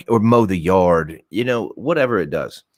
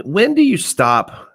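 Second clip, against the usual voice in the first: high drawn-out; 13 semitones higher, 4 dB louder, slower than usual. Pretend.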